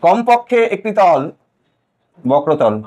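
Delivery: slow, word by word for copying; measured dictating pace; slow dictation. Shown as a demonstration. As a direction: dictate it slowly so they can write. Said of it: "slow হচ্ছে পড়া".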